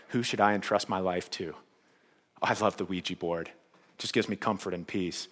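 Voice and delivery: low, hushed mock confession; quiet, deep-voiced parody of a believer; mock-devoted Saul. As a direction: murmur it low and soft, like tender devotion. Mock quote of Saul with Ouija board.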